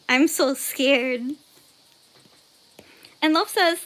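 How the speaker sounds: normal talking voice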